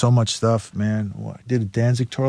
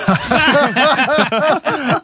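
high burst of real laughter; big laugh; 14 semitones higher than usual. Real, not acted. Laughing hard at the booking agent news.

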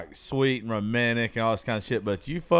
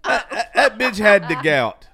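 laughing; 6 semitones higher, 5 dB louder than usual. Real, not acted. Laughing over friend's gout.

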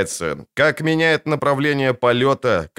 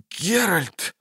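moaning; 8 semitones higher, 4 dB quieter than usual. A narrator's reading. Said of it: "простонал он".

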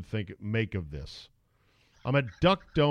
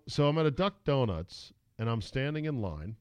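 ordinary delivery

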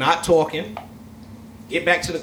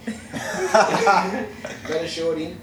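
laughing; much slower than usual. Real, not acted. To laughing friends, repeating the punchline while laughing. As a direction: stretch it out slowly through a laugh.

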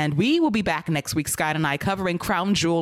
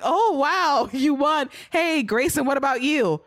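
high-pitched wowed voice, imitated; comic, high, wide-eyed wonder; mock amazed realization; 9 semitones higher than usual. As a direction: play delighted surprise, then turn to someone new. Acts out R-Truth's belated realization.

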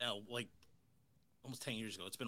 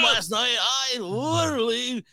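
radio comic voice; 7 semitones higher, 7 dB louder than usual. Retold or imitated. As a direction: lay it on like a morning show comic. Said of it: "imitating Bob and Tom bit".

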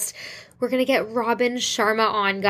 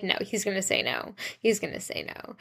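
hushed self-doubt; 10 dB quieter than usual. Retold or imitated. Host retelling her fear he would refuse, hushed. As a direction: mutter it much softer, to yourself.

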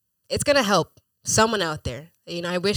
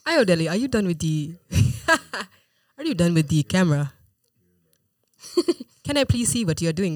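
a lightly put-on African accent, calling to a friend; playful African accent with a laugh in it; 4 dB louder than usual. Teasing the cameraman about the camera, in a mock African accent. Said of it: "my African one's pretty good".

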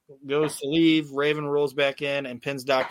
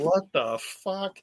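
bewildered Drago; 6 semitones higher, slower than usual. Retold or imitated. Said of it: "and he's just like".

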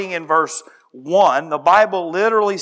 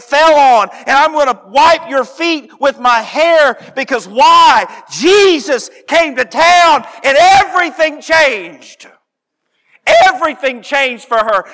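loud, higher preaching climax; preaching at full force; 6 semitones higher, 7 dB louder than usual. Acted. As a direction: build the sermon to a shout. Preaching climax on Mary's worship.

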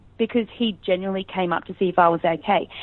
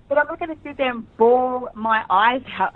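ordinary delivery

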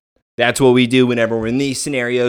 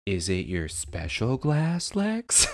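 soft, slow mock coaxing; mock soothing doctor; 8 dB quieter, slower than usual. Doctor coaxing Lex about the glass, soft slow mock voice. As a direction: coax him like a child.

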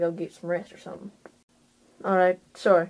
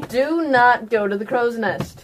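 slow, louder stern command; stern order; 4 semitones higher, 7 dB louder, slower than usual. Acted. Captain forbids the girls the crow's nest, stern slow order. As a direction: lay down the law to the crew.

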